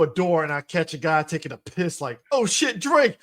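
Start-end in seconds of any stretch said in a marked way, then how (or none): 2.3-3.2 much higher, louder startled shout; hammed-up surprise; mock startled cry. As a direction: yelp like you walked in on someone. Game character surprised in desert.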